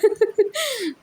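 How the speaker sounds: high burst of laughter; real, high-pitched laugh; amused laugh. Real, not acted. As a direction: burst out laughing at your own unsaid words. Holds back harsh words, laughs.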